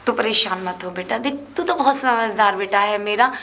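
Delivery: low, soft coaxing to calm her son; soothing mother. Acted. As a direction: comfort him quietly.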